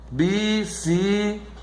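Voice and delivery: slow, split bi-ci-cle-ta; syllable by syllable. Shown as a demonstration. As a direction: say "Bicicleta" slowly in separate syllables. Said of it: "você divide a sílaba".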